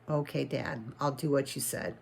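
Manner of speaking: soft, low, disappointed little voice; sad. Acted. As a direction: give in quietly, heart sinking. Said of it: "that made him so sad inside".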